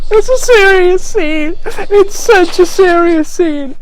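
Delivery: high, slow, giggling through the words; can't keep a straight face; real laughter breaking the words; laughing. Real, not acted. Breaking up laughing at own acting.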